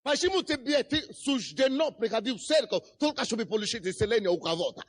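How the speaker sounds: normal talking voice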